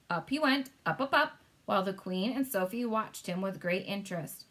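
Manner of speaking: ordinary delivery, nothing marked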